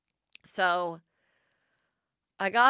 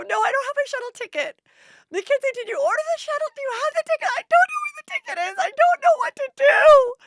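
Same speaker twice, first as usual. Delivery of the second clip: very high, loud, fast wailing; hysterical sobbing; 10 dB louder, faster than usual. Retold or imitated. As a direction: bawl it out in a panic. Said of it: "Now I'm just off crying".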